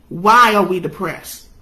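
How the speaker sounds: usual speaking voice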